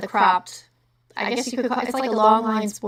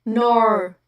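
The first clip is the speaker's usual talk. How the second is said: Australian-style "Nor" for "no"; tentative imitation of an Australian "no"; attempted Australian accent; 4 dB louder than usual. Shown as a demonstration. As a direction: try the Aussie vowel on "Nor". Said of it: "like, the no sound".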